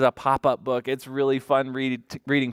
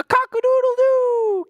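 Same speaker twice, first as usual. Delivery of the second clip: rooster crow; 7 dB louder than usual. Acted. Reading rooster page to toddlers.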